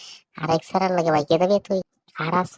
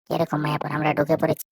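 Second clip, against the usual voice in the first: ordinary delivery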